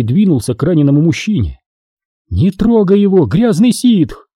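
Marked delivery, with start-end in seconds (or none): 2.3-4.3 angry shout. Acted. Captive woman shouts at Sith.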